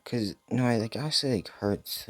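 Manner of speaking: high, softened lament; sad. Real, not acted. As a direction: let the sadness lift and soften the voice. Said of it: "I'm so sad".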